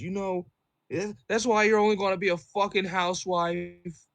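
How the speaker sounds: a bitter guest spitting his last jab; mock nasty insult. Customer's parting insult.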